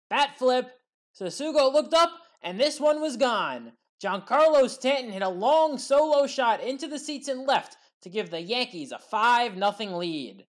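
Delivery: ordinary talk, nothing marked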